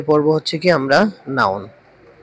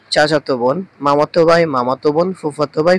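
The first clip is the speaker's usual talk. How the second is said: usual speaking voice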